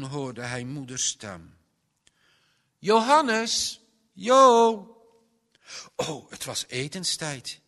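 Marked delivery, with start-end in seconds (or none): 2.8-4.9 high, loud call across the house; loud, rising mother's call. Acted. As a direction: call him in for dinner. Mother calls child to dinner.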